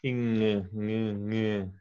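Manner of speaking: "ing, ng" as a nasal ng with no hard G; subtle ng. Shown as a demonstration. Tutor isolates the ng ending for the student. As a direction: hum the "ng" ending softly. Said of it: "the G is very subtle".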